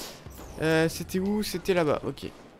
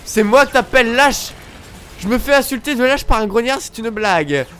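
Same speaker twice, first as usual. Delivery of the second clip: loud, higher offended rant; indignant outrage; 5 semitones higher, 7 dB louder than usual. Real, not acted. Player called a coward by a grunt, loud indignant rant. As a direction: take it as a real insult, loud.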